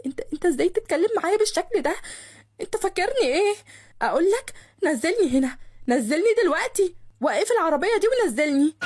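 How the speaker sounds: high, agitated, offended demand; indignant outburst. A narrator's reading. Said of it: "وهي بتشهق بصوت عالي". (منفعلة وبتزعق بغيظ)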